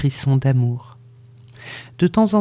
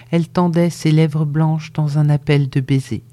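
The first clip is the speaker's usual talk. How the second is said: usual speaking voice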